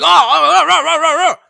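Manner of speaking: mock yelling, high and loud; startled ranting. Startled stranger yelling at a little dude, mocking imitation. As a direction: imitate his wordless yelling. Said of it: "situations where he got startled".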